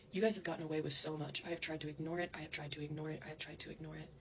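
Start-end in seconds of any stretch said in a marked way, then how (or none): 1.5-4.1 each repeat softer until near silence; fading repetition. Real, not acted. Trying to ignore the bullying, fading to nothing. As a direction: let it die away as you repeat.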